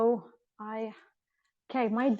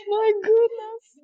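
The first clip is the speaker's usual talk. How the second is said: much higher, louder, slow, drawn out; delighted squeal; 12 semitones higher, 9 dB louder, much slower than usual. Real, not acted. Vlogger sitting in the real barn, squealing with delight.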